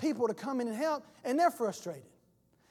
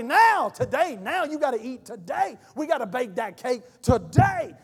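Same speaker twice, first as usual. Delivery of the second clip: loud, pushy urgency; eager evangelist; 2 semitones higher, 9 dB louder than usual. Acted. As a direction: shout it like a fired-up evangelist. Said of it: "The evangelist is wanting to run into them".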